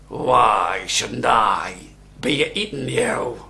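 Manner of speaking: very slow, low troll threat; big, deliberate monster voice; menacing troll. A narrator's reading. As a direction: loom over the goat, stretching each word.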